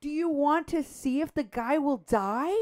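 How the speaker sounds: high-pitched, appalled indignation; outraged disbelief. Real, not acted. Outraged at people filming the danger.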